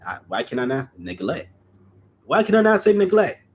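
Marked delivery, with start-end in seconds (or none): 2.2-3.4 high, fast, loud disbelief; baffled outburst. Real, not acted. Reader stumbling over a word, baffled and exasperated. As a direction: exclaim in frustrated surprise. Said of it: "Why do I have a weird accent".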